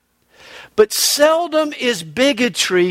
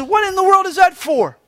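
outraged shout; 9 semitones higher than usual. Retold or imitated. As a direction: yell it out in shock, pitch jumping way up.

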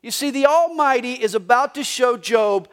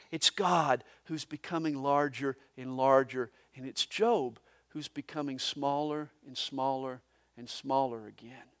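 lower, softer, shrinking away; fading to a hush; 8 semitones lower, 11 dB quieter than usual. Real, not acted. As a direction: let the voice shrink with the words. Shrinking, words fade away.

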